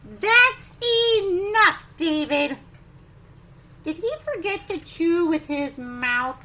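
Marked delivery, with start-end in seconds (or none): 0.1-2.6 loud, higher that's enough; firm loud reprimand; fed-up parent. Acted. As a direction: snap it out like a parent at the end of patience. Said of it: "parent's line from the book".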